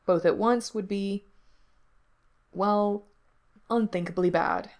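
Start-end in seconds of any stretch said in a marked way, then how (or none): none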